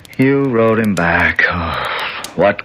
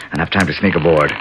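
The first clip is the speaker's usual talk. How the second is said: usual speaking voice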